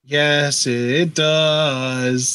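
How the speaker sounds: drawn-out sing-songy retort; sing-song comeback. Real, not acted. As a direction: stretch it out to needle your co-host. Teasing reply in sing-song voice.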